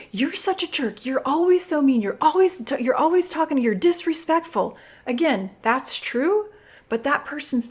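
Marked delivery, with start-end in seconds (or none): 0.1-4.8 higher, whiny blaming voice; mock accusation. Shown as a demonstration. Parent blaming a partner, whiny accusing. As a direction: pile on the blame, nagging.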